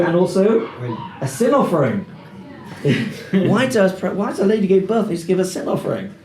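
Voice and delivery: ordinary talk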